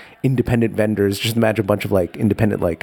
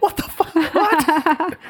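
shocked vendors; much slower than usual. Retold or imitated.

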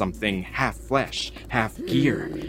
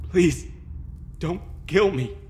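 frightened plea; 8 semitones higher than usual. A narrator's reading. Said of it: "Words bubbled up in my throat".

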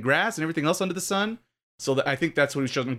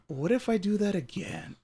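higher, softer, slow musing; imitating the director; 6 semitones higher, 6 dB quieter than usual. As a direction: ponder it like a mock brainwave. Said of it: "he's like".

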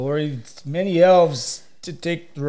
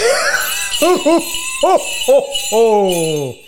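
loud jolly belly laugh; hearty Santa laugh; 9 semitones higher, 6 dB louder than usual. Acted. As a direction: laugh long and booming. Santa laughing at his secret.

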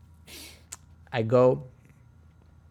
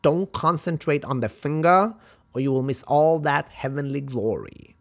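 imitated kung fu master, higher and emphatic; accented film-master impression, raised pitch; Bruce Lee; 4 semitones higher, 5 dB louder than usual. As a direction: scold the student like a martial arts teacher. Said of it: "and he's like".

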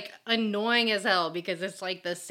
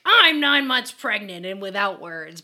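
mock indignant; 6 dB louder than usual. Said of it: "And she's like".

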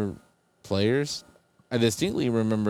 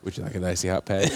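lower-pitched celebrity impression; Shaq; 4 semitones lower than usual. Co-host joining in the Shaq joke with a low Shaq voice. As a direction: play the big pitchman, voice dropped low. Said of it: "I sound like Shaq".

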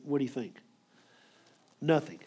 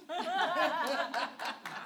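a high breathy burst of laughter; high amused laugh; 11 semitones higher, 8 dB quieter than usual. Real, not acted. Preacher laughing at his pastor's story, high laugh. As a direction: laugh out at the punchline.

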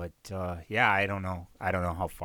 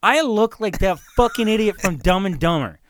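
louder, higher, fed-up rant; loud disbelieving complaint about the haircut; exasperated outburst; 8 semitones higher, 8 dB louder than usual. Retold or imitated. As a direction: blurt it out, appalled at the haircut. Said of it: "I'm like".

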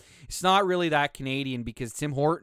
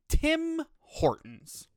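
slow, high, mocking Tim Hortons; drawn-out scorn; 13 semitones higher, much slower than usual. Real, not acted. Podcaster sneering at coffee chain, slow mocking drawl. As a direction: stretch "Tim Hortons" out slowly with disdain.

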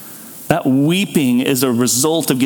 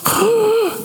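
sobbing gasp. Shown as a demonstration. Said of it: "have you ever heard somebody sob?".

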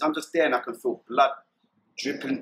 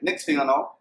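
ordinary talk

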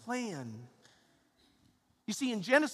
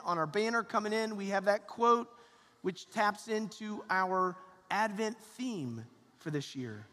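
normal talking voice

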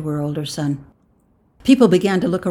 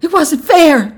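high, loud complaint; protesting; 12 semitones higher, 11 dB louder, slower than usual. A narrator's reading. Wife protests to the official, high and loud. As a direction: insist you were wronged.

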